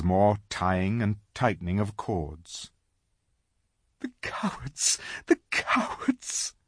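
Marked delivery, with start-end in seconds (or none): 4.0-5.0 tearful, whispered cry of cowards; sobbing whisper. A narrator's reading. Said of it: "sobbed Susan". 5.3-6.5 slow, whispered sob rising high; sobbing whisper, breaking high. A narrator's reading. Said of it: "sobbed Susan".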